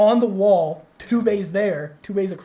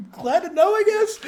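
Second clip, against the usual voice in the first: wry reply; 12 semitones higher, 3 dB louder than usual. Retold or imitated. Reacts to a coworker's reassurance.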